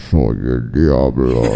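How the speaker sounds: very low, slow demonic voice; monstrous devil voice. Acted. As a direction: growl it like the devil on the radio. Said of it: "voz monstruosa".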